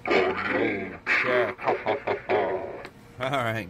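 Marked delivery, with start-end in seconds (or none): 0.0-1.6 low, slow, metallic voice changer; clangy robot voice. Shown as a demonstration. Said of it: "Now let's turn on the voice changer". 1.6-2.9 low metallic laugh through voice changer; robot laugh. Shown as a demonstration. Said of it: "Now let's turn on the voice changer".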